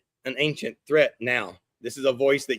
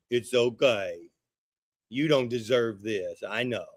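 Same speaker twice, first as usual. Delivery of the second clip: slow, low, soft coddling; mock soothing; 4 semitones lower, 5 dB quieter, slower than usual. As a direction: comfort them like a pampered child. Voices coddling comfort he rejects.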